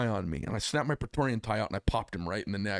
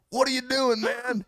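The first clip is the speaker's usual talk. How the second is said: alarmed friend; 13 semitones higher than usual. Retold or imitated. Friend alarmed at shark aboard.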